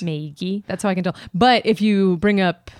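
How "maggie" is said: "Maggie" said as MAY-ghee; Wisconsin pronunciation. Shown as a demonstration. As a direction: say "Maggie" with a long ay. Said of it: "how everyone in high school said".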